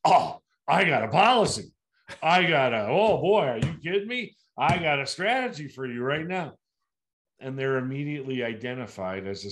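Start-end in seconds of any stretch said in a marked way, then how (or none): 0.0-6.6 loud, high, overeager sales pitch; mock the agent who jumps straight to selling; hammed-up hard sell, pouncing on the client; pushy salesman. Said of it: "here's what most agents ... do".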